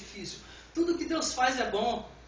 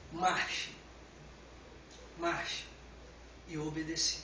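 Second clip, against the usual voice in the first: low, soft preaching with long pauses; solemn, hushed; 6 semitones lower, 6 dB quieter than usual. Real, not acted. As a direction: let each phrase sink in, quietly and slowly. Solemn sermon theme, God's command. (solene, em voz baixa e grave)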